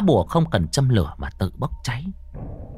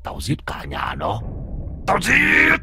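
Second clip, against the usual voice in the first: ghost shouting; 7 semitones higher, slower than usual. Acted. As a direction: snarl then shriek like a ghost. Ghost rages at the exorcist.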